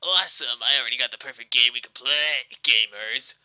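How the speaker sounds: loud, fake-friendly game mascot; loud, slightly off childlike greeter; Sonic the Hedgehog. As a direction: attempt a playful cartoon-hero voice. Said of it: "trying to sound like Sonic the Hedgehog".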